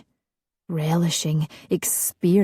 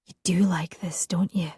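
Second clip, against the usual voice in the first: teasing whisper into his ear; 4 dB quieter than usual. Acted. Fiona teasing Alistair, whispered in his ear. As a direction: breathe it right at his ear. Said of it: "whispering against his ear".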